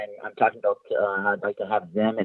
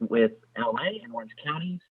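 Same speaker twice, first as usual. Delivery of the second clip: phone held away; 5 dB quieter than usual. Real, not acted. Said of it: "Could you speak closer to the phone".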